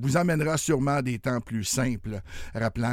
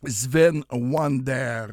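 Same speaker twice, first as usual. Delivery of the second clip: slow announcer name; 5 dB louder, much slower than usual. Acted. Radio host announcing artist name.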